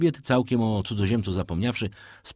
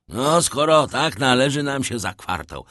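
put-on gruff old sailor; 5 semitones higher, 6 dB louder than usual. A narrator's reading. Penelon, old sailor, voiced by narrator.

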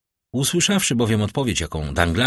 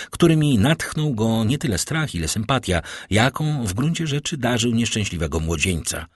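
ordinary delivery

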